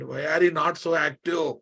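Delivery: ordinary talk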